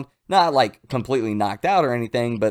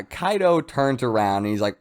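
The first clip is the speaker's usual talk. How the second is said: ordinary delivery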